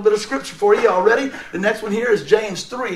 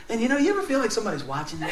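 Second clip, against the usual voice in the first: ordinary talk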